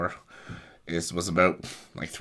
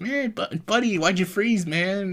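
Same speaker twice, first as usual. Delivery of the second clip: much higher, mock-puzzled dad; dad teasing his son in the car, pitched way up; 12 semitones higher than usual. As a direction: playfully ask why he froze.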